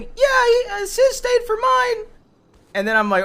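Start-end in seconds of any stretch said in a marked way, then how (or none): 0.0-2.0 much higher mock voice of his wife; mimicking wife. Husband mimics his wife's excuse in a much higher voice. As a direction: pitch it up to mimic her.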